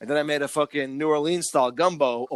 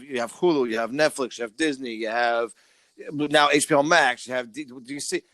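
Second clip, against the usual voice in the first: ordinary delivery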